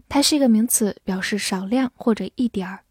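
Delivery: ordinary talk, nothing marked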